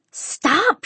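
high, exasperated cry to stop; fed-up daughter's shout; finally shouting. A narrator's reading. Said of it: "she finally shouted".

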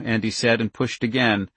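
plain reading voice

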